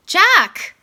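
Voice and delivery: sharp, high, loud call of his name; stern mom. Acted. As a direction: snap his name out like an upset mother. Mom scolds son in office.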